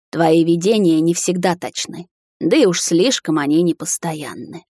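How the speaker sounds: usual speaking voice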